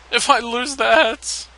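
mock-tearful high, loud plea; Pikachu. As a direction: play a slow, high-pitched near-cry. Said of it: "Oh no, not Pikachu crying".

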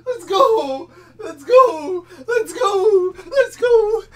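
high, loud celebration shouts; excited shouting. Real, not acted. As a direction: yell it out in triumph. Celebrating the pull.

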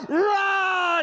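high drawn-out roaring yell; lion roar shout. Acted. Preacher voicing the beast's roar at the sheep lion, long shout. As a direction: roar it like a lion across the river.

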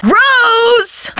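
high, drawn-out holler; calling out. Acted. Calling Rose from another room, high and long. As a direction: call a name to someone far off.

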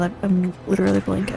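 ordinary talk

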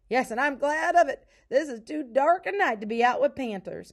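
high, loud, hearty character voice; emphatic relief. A narrator's reading. Pa to Ma after escaping the panther, emphatically relieved.

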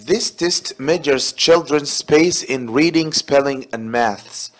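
reading the sentence out slowly and carefully; slow exam read. Shown as a demonstration. Teacher to students, reading the exam sentence slowly. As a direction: read it like a test item for the class.

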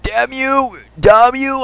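high, rising outrage at the archer; angry high curse. Real, not acted. Shot in the knee.

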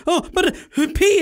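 small high-pitched plea; meek high whimper. Retold or imitated. Desperate passenger pleading with the flight attendant, high and meek. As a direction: whine it like a desperate kid.

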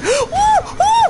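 very high whoop; excited whoop. Real, not acted. Hype while dodging monster.

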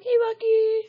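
high, drawn-out pet-calling voice; calling the dog. Real, not acted. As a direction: stretch it out, climbing high to call a dog.

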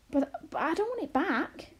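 high-pitched, stammered protest; swooping, agitated objection; exclaiming. A narrator's reading. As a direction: exclaim in dismay. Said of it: "exclaimed Arrietty".

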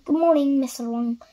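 ordinary delivery, nothing marked

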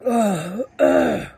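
choked, strained gasps; choking. Real, not acted. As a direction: gasp while choking. Choking on spicy candy.

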